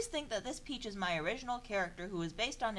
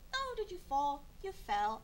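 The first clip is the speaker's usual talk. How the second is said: pet talk; 5 semitones higher than usual. Real, not acted. Talking to her pet bird.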